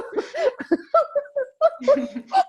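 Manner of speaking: high, loud laugh near tears; helpless laughter. Real, not acted. Speaker cracking up while telling her daughter's saying, real laughter. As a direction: laugh until it almost cries. Laughing fit mid story.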